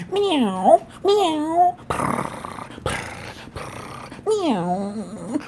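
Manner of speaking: very high, drawn-out meows; cat meowing. Pretend. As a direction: mew like a cat on request. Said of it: "Okay, here's a cat".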